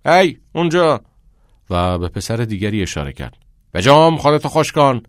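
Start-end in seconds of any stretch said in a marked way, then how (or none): 0.1-1.0 high, loud coach's commands; shouted orders. A narrator's reading. Gym teacher bosses boys in showers, shouting. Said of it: "معلم ورزش داد زد". 3.7-5.0 loud, raised-pitch order; coach shouting. A narrator's reading. Gym teacher points at a boy, shouting.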